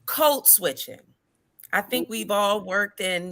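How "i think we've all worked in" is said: ordinary talk, nothing marked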